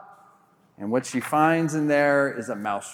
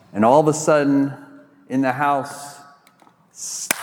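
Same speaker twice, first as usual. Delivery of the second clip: normal talking voice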